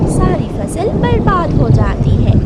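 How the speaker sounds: loud dramatic narration of ruin. A narrator's reading. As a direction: boom out the disaster. Narrator over storm. (ज़ोर से नाटकीय वर्णन)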